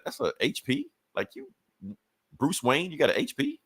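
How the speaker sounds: high, disbelieving "a H P?"; mock disbelief at his cheap computer; incredulous. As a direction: scoff at Bruce Wayne owning an HP. Mocking Bruce's cheap computer.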